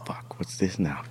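hushed worry to himself; whispered dread. Retold or imitated. Traveler being taken into a side room, whispered dread. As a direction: whisper it like a sinking private thought. Inner thought, led into a room.

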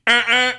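two quick, loud, high toots; imitated friendly honk. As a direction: toot hello to the neighbors. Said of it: "I always do the honk".

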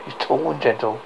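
ordinary delivery

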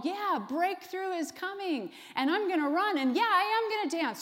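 high, hammed-up excited worshipper; mock a churchgoer getting carried away, pitched high; super excited. Preacher imitating a churchgoer hyped up at one service, super excited. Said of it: "get super excited".

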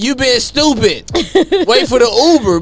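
mocking laugh. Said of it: "And she like".

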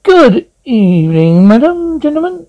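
polite greeting climbing very high; disapproving attendant. A narrator's reading. Green blur greeting stranded guests, disapproving attendant. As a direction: rise into a high, questioning formality. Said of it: "watched them disapprovingly. It coughed".

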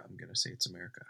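hushed, half-sure guess; conspiratorial whisper. Real, not acted. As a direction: whisper the answer like a secret.

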